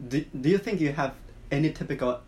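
ordinary talk

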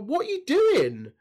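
drawn-out confused disbelief; baffled outcry. Retold or imitated. Imagined fan reacting if Apollo drops the accent, baffled. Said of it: "you'd be like".

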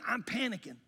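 normal talking voice

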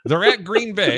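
high, loud mock outburst; hammed-up loud exclamation. Real, not acted. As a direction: overplay the announcement.

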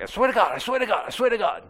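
high, rapid-fire repeated pleading; glib insistence. Acted. The guy who swears about everything, glib insistence. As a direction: rattle off the same high-pitched vow each time.

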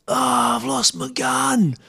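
high, slow drawn-out wail of loss, half-joking; imitating a mate groaning over a lost gun; mock despair. Mate in the water groans about losing his speargun, mock wail. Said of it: "And I hear this".

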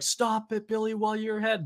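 high mock-pleading voice; protesting partygoers. Half the room begging Billy to stop, high mock protest.

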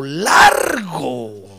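largo stretched very long; drawn-out emphasis. Shown as a demonstration. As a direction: drag out largo to show length. Drags word to illustrate length. ("largo" muy alargado, con énfasis)

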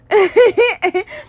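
high, loud giggle; short laugh. Real, not acted. Amused at own mock goodbye to the past, high giggle. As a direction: burst into a quick high giggle.

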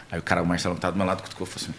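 ordinary delivery

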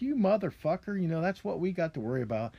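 replaying his anger at Kerry's statement, loosely; retold angry outburst. Ranting about Kerry's climate remark, mock furious outburst. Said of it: "I got really pissed off".

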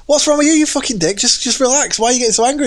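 retold road rage yelling; mock angry yell. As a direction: bawl it out at the other driver.

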